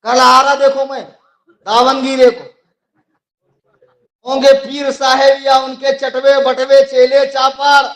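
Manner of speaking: loud, high, forceful challenge to rivals; fiery preaching. Real, not acted. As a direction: thunder it out like a debating preacher. (जोशीली, ललकारती तक़रीर)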